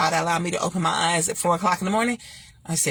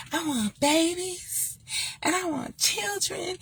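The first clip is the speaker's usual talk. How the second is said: high, soft, slow wistful plea; pleading woman; 10 semitones higher, 6 dB quieter, slower than usual. Retold or imitated. High and soft, Robin pleading wistfully for children. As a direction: plead softly for babies. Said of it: "and she wanted, you know".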